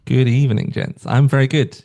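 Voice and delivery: loud, eager greeting; real excited hello. Real, not acted. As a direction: greet them keenly, voice lifted and loud. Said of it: "I got so excited".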